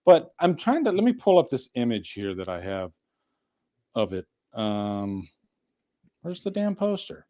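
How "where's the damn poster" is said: normal talking voice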